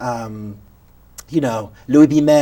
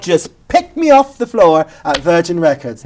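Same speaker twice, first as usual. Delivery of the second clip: usual speaking voice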